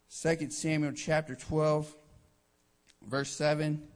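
ordinary talk, nothing marked

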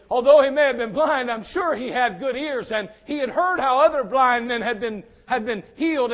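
ordinary talk

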